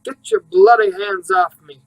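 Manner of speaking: loud, slow British shout from behind a door; furious yell. A narrator's reading. Man behind the closed door yells at police, furious British shout. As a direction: bellow it, fighting off his captors. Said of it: "someone yells".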